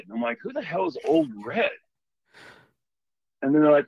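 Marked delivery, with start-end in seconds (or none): 0.4-1.7 much higher, baffled who-the-hell; incredulous question. Retold or imitated. Asking the old timers who Old Red is, incredulous and high. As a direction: disbelief that the ghost has a name. Hearing the ghost has a name.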